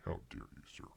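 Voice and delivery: breathy mock indignation; whispered outrage. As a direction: hiss the mock offence quietly.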